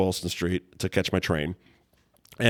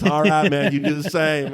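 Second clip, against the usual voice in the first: put-on McConaughey accent; 6 semitones higher, 3 dB louder than usual. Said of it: "I get to do my McConaughey accent badly".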